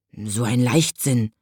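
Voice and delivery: low, slow grumble; grumbling. Acted. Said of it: "brummte der kleine Nachtwächter".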